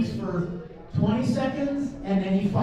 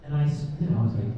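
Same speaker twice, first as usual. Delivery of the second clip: normal talking voice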